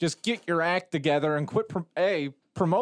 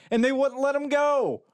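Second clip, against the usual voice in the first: high-pitched, incredulous outburst; can't believe they did it; exasperated disbelief; 8 semitones higher than usual. Real, not acted. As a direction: pitch leaps up in frustration. Exasperated rant about studio's decision.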